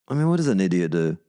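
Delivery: ordinary talk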